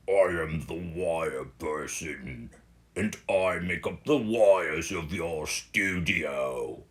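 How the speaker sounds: put-on slow, low, plummy English accent for a talking cable; mock-grand English accent for the imaginary wire person. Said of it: "the wire person has an English accent".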